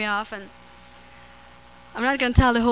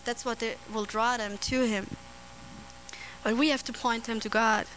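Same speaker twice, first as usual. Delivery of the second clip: usual speaking voice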